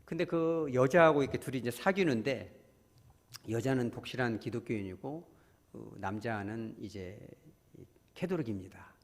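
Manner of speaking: ordinary talk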